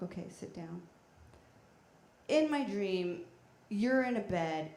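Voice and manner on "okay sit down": hushed. Retold or imitated. Settling in to tell dream.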